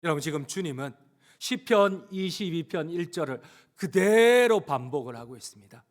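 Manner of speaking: ordinary delivery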